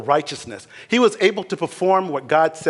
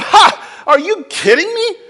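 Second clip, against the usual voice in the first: mocking laugh; 13 semitones higher, 7 dB louder than usual. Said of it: "It wasn't a laugh of".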